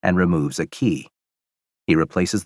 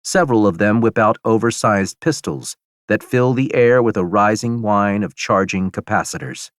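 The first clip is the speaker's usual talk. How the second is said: plain reading voice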